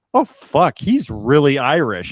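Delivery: loud, high astonished reaction; imitating a stunned audience's discovery; surprised realization. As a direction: blurt it out, pitch jumping up. Voicing everyone's surprised reaction.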